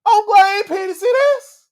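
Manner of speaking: high, loud yelling in disbelief; outraged disbelief. Real, not acted. Fan shouting at the TV over the drowning spot, outraged yell. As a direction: shout at the screen, can't believe it.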